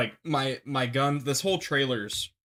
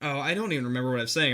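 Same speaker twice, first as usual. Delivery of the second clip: usual speaking voice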